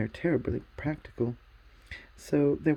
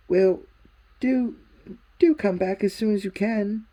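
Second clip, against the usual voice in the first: put-on boy's voice, higher and louder, urging; Diggory pleading; 7 semitones higher, 7 dB louder than usual. A narrator's reading. Diggory begging Polly to return, higher, louder plea. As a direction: beg her to come back soon.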